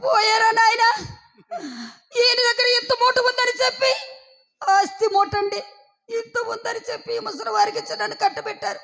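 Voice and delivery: high-pitched comic widow's wail; crying. Acted. New widow bewailing her lot to the servant, high-pitched crying. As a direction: lament in a high, keening voice. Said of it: "ఎందుకండి ఏడుస్తున్నారు".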